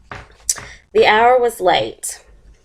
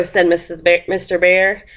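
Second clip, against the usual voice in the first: ordinary delivery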